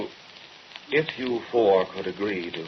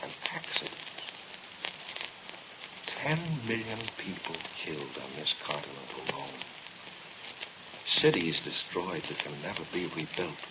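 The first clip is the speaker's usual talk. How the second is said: breathless, hushed and much softer; hushed horror; 6 semitones lower, 15 dB quieter than usual. Acted. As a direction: let the horror drain the voice to a near whisper. Horrified reproach after the catastrophe.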